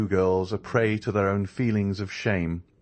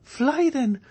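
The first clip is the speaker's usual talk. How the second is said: much higher, louder urging shout; urging cry; 4 dB louder than usual. A narrator's reading. D'Armilly urges Andrea to run, crying out. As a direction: push him to escape. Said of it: "cried Mademoiselle d'Armilly".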